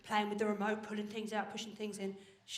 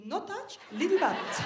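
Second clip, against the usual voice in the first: higher mock-Italian toddler voice; toddler's Italian accent; 5 semitones higher than usual. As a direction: play a small child with an Italian accent. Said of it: "she sounded Italian".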